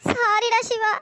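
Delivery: sobbing, broken into short phrases; crying. Acted. Girl tells injured Siva she can't stop, crying. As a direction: apologise through tears you can't stop. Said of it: "இப்படி அழுதுட்டு இருக்க?".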